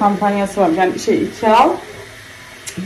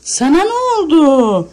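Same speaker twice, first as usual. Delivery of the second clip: high comforting; 9 semitones higher, 4 dB louder than usual. Real, not acted. Mother comforts the crying child.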